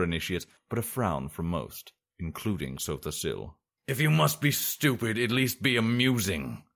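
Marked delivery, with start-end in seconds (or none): 3.9-6.6 growled rebuke. A narrator's reading. Sorcerer to a joking young student, growling. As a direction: scold a foolish pupil with a touch of growl. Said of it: "growled the sorcerer".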